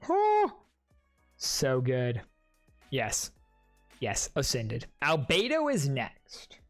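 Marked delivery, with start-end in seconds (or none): none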